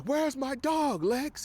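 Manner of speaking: high, strained angry demand; pissed-off demand. Retold or imitated. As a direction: demand the dog back, furious and high. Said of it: "He's pissed off".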